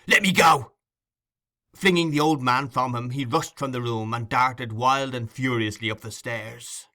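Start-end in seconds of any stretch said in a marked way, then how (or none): 0.1-0.7 loud, high command; fierce. A narrator's reading. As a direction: roar it while breaking free.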